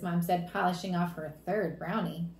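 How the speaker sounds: plain reading voice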